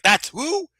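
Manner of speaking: very high, incredulous question; mock-shocked rising squeak; retold disbelief. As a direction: imitate a stunned friend, pitch leaping up. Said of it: "You're like".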